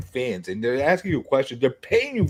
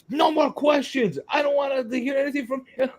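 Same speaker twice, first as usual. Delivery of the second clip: high shouted imitation of the artist; mock shout; 11 semitones higher than usual. Imitating the artist banning him from the stream, high mock shout. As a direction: yell it high like he's flipping out. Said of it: "all I did is ask her".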